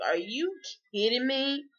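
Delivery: disbelieving. Retold or imitated. Disbelief at passing food shops.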